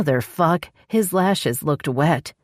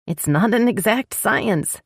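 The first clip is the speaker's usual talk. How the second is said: nervous; 7 semitones higher, slower than usual. A narrator's reading. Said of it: "Becky sounded nervous".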